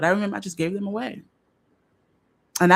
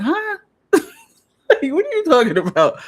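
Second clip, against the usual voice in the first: confused partner; 13 semitones higher, 6 dB louder, slower than usual. Retold or imitated.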